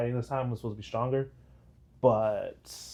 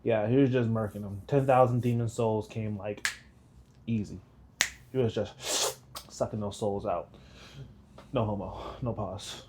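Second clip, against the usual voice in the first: ordinary talk, nothing marked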